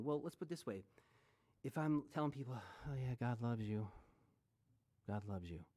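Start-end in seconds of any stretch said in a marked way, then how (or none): 2.5-5.6 flat, bored, lower by the last line; dead, joyless "God loves you", trailing off quieter; unconvincing, flat. Shown as a demonstration. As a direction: say it like you don't believe it at all. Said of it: "Wow, that's convincing".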